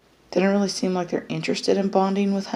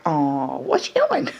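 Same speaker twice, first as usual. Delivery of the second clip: a hint of a nosy little guinea pig; mild squeaky pet voice asking what's up; curious guinea pig voice. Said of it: "And Sweetie's like".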